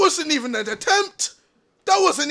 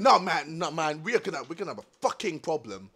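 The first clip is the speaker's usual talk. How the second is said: low, soft muttered warning; 14 semitones lower, 11 dB quieter, faster than usual. Real, not acted. Player warning the one screaming, low and soft. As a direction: drop the voice right down to warn.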